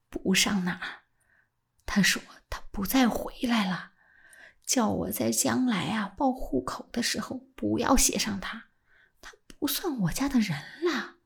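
whispered, secretive; hushed secret. A narrator's reading. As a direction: keep it hushed and urgent. Secret told in low voice. (压低声音的秘密)

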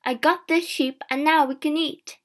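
happy. A narrator's reading. James brings food home, happily. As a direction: share the good news gladly. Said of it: "James said happily".

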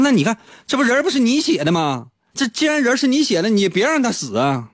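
high, pleading protest; exasperated friend. Retold or imitated. Friend arguing with Flaubert about killing the character, mock exasperation. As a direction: argue him out of it.

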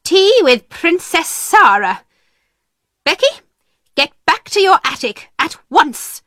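high, scolding headmistress; angry. A narrator's reading. Miss Minchin sending Becky back to her attic, angry. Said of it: "she said angrily".

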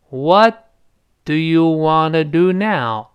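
slow, careful linking of each sound; slowed-down demo. Shown as a demonstration. Teacher models for weaker learners, slow and deliberate. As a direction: take it slowly, pausing after a stretched first word. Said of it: "慢点儿".

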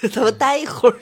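says it through a chuckle; joking, with a light laugh. Real, not acted. Joking about staying in the low. (笑着说)

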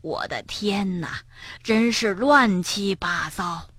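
hushed, slow dismay; dismayed granny. A narrator's reading. Granny entering the wrecked kitchen, dismayed. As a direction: say it softly and slowly, taken aback.

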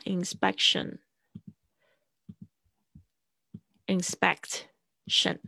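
inspection said very slowly and softly, syllable by syllable; split into syllables. Shown as a demonstration. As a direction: break inspection apart slowly. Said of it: "我要把它就是分一下". (把inspection拆开慢慢念)

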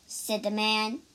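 ordinary delivery, nothing marked

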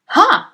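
high, loud contemptuous huff; scornful snort. Acted. Headmistress snorts loudly at the teacher's idea. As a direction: snort in derision. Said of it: "snorted Miss Trunchbull".

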